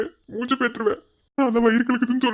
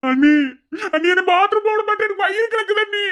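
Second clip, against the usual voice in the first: very high, tearful complaint; hammed-up crying appeal; wailing plea; 8 semitones higher, 3 dB louder than usual. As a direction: bawl to your sister-in-law for rescue. Begs sister-in-law over stomach trouble.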